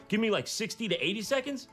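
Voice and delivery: normal talking voice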